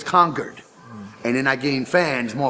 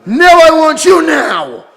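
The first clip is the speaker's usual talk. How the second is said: put-on much higher, louder, slow laughing girl's plea; mock needy girl; 13 semitones higher, 11 dB louder, slower than usual. Mocking the groupie to the host in a squealing girl voice. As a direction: ham up a clingy woman begging. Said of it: "She comes back after getting banged".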